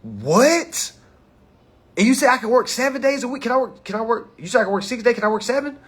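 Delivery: lightly voiced, excited worker asking about hours; imitating eager worker. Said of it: "That say, Yo".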